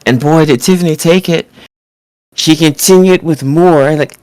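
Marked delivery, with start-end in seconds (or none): none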